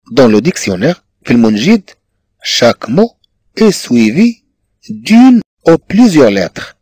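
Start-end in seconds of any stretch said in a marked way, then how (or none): 2.4-6.8 slow dictation. Shown as a demonstration. Teacher dictating French phrase.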